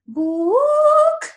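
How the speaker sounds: high, loud, drawn-out "book"; big swooping cartoonish announcement; mimicked show intro. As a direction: hoot it way up high and hold it. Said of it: "That's how they introduce it".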